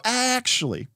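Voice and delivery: high, drawn-out "actually"; coy objection. Acted. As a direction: interject with a mock-coy, high-pitched swoop. Acted player slyly declining help.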